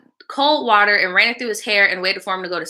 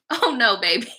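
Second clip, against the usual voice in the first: ordinary talk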